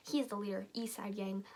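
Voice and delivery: usual speaking voice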